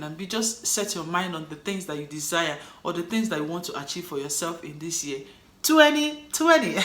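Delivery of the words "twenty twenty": laughing. Real, not acted. Laughs at the year.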